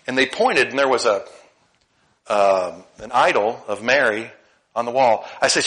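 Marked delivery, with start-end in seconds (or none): none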